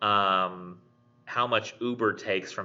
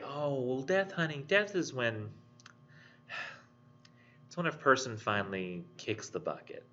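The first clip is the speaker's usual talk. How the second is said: put-on higher, softer, soothing tone to a child; gentle explaining; 4 semitones higher, 6 dB quieter than usual. Adult softly explaining death to young learner, tender. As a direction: ease the hard word gently, with a sigh. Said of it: "And so they say".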